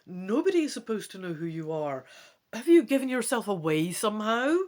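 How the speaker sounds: snel en steeds luider, gealarmeerd. Acted. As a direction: schiet in de paniek. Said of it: "in alarm".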